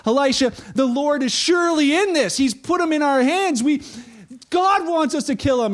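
a king talking himself into revenge; eager king. Retold or imitated. King urges Elisha to kill enemies.

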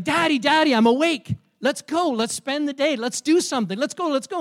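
put-on high, loud, eager child pestering his dad; excited little boy. Little boy waking his dad to play, excited. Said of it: "jumping up and down with excitement".

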